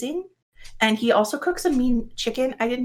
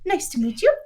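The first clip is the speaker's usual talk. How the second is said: perky high intro; 9 semitones higher than usual. Acted. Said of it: "she's like".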